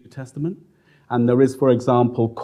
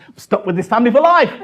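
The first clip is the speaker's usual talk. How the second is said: mock despair, louder; 11 semitones higher, 8 dB louder than usual.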